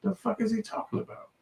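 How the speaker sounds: very high, soft disbelief; incredulous. Retold or imitated. Reacting to the clip, very high and soft.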